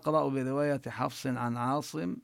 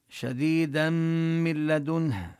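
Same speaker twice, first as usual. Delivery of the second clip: recitation; 2 semitones higher, 4 dB louder, slower than usual. Shown as a demonstration. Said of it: "tajweed recitation demo".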